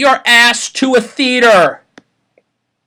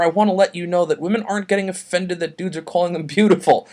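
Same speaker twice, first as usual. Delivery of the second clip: ordinary talk, nothing marked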